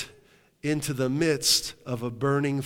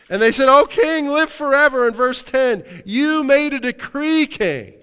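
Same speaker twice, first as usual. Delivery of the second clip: much higher and louder, proclaiming; loud preaching; 12 semitones higher, 11 dB louder than usual. Acted. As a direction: cry out the accusers' flattery. Preacher voicing the accusers.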